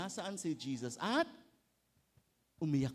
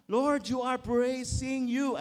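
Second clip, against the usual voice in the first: slow, loud, tongue-tied praying; a hammed-up garbled prayer; fumbling mock prayer; 4 semitones higher, 9 dB louder, slower than usual. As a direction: stumble over the words of a prayer in an odd voice. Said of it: "bulol-bulol ka na eh".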